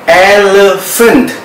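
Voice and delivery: elephant said slowly, ph as f; slow model pronunciation. Shown as a demonstration. Teacher repeats elephant slowly for students. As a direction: drill elephant slowly for the class. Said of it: "Sekali lagi".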